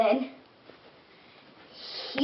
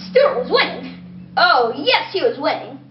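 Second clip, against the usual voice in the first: plain reading voice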